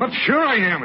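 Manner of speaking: high, agitated retort; trembling agitation. Acted. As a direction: burst out, worked up and pitched high. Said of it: "and you're trembling".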